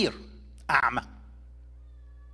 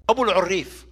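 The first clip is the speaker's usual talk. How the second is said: ordinary talk, nothing marked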